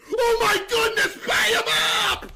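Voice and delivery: loud, very high wailing yell; anguished scream. Real, not acted. Streamer screaming after convicting an innocent man, anguished. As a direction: scream it like it hurts. Screaming over a wrong verdict.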